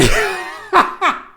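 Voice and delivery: high-pitched burst of giggling; real laughter. Real, not acted. Streamer hearing grandpa used to be pop-up, laughing. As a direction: burst out laughing mid-sentence.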